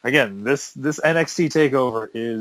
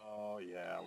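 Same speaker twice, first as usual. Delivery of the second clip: normal talking voice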